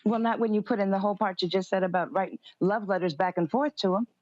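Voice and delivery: ordinary delivery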